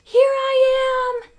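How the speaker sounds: high, slow, loud stretched call; calling back. A narrator's reading. Stuart calling back from inside the rolled-up shade, high stretched call. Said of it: "called back".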